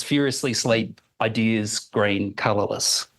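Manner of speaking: "furiously sleep ideas green colourless" slowly, each word dropping separately; staccato falling tone. Shown as a demonstration. Lecturer demonstrating Chomsky's staccato reading. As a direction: read it as a list of unconnected words. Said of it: "read in the same staccato fashion".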